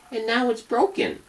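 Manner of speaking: high little cub voice; tiny Baby Bear voice. Acted. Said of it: "in his tiny little voice".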